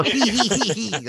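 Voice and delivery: high shrill hee hee hee; witch cackle. Retold or imitated. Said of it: "Go ... like that".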